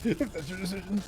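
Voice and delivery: mock battle challenge, jumping high on the threat; mimicked game hero. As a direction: build to a high, hammy battle cry. Mimics the game line just heard.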